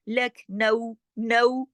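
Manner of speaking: slow नऊ with a clear a-to-u glide; model diphthong. Shown as a demonstration. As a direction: dwell on the ending of लखनऊ, then repeat नऊ. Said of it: "आपकी आवाज में ये आवाज पैदा होना चाहिए". (नऊ की आवाज उभारकर)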